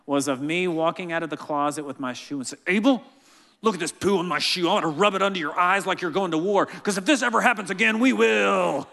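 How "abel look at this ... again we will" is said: louder, higher mock drill sergeant roar; hammed-up macho man voice; epic macho man. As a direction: ham up a macho war speech. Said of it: "this epic macho man voice".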